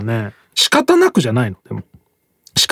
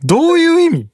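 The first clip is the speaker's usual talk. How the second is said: mock innocent question. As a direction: parody the co-host's naive question in a squeaky voice. Echoes co-host's clueless question.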